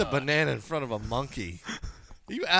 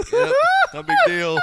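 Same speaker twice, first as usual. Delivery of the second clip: mock casual; 8 dB louder than usual. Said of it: "You're just like".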